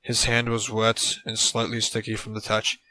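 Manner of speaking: ordinary delivery, nothing marked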